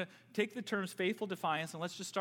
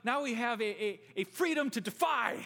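eager defiance; 7 semitones higher, 5 dB louder than usual. Retold or imitated. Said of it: "we can say".

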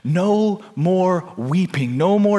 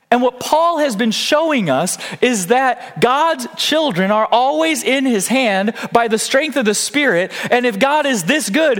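high, rousing proclamation; impassioned preaching; 10 semitones higher, 4 dB louder than usual. Real, not acted. Preacher assuring God's children they are in his hand, rousing proclamation. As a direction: preach it high, climbing to the last words.

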